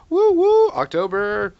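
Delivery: high, loud whoop for October; excited cheer. Real, not acted. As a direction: cheer it out, woo woo.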